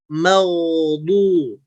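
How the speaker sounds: "meldu" said as dark "maudu"; thick correct reading. Shown as a demonstration. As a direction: open "meldu" with a thick, backed "mau". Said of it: "Nah, seharusnya". ("meldu" dibaca tebal seperti "maudu")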